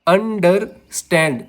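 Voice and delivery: Understand slowly in syllables, weight on stand; stress on last syllable. Shown as a demonstration. Teacher giving an anapest example, slow stress on stand in understand. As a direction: split understand, landing on stand. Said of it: "मैं इसको कैसे बोल रहा हूँ".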